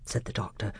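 usual speaking voice

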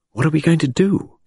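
ordinary delivery

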